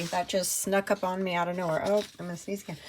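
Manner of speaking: normal talking voice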